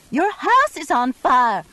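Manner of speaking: high, loud frantic shriek of alarm; screeched warning; screeching warning. A narrator's reading. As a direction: scream it down the phone in panic. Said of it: "I screeched".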